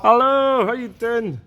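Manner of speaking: high, bright put-on hello; high silly greeting voice. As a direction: greet them in a squeaky high voice.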